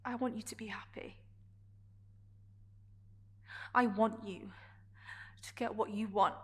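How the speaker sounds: suddenly soft, with careful pauses; tender appeal. Acted. Character wishing Adrian happiness after the argument, tender. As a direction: drop the fight and speak gently.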